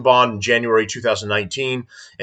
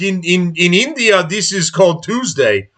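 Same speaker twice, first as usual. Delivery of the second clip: a rough, raised imitation of an Indian accent, slowed at the end; lightly mock the driver's Indian accent, sneering; 7 semitones higher than usual. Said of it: "looking around like".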